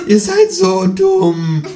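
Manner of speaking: high, loud, drawn-out kangaroo squawk; dragged-out cartoon kangaroo insult; exasperated kangaroo. A narrator's reading. Kangaroo scolds the voters.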